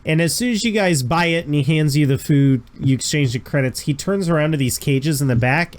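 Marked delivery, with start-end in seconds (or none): none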